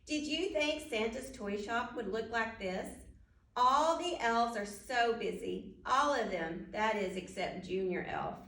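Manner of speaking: plain reading voice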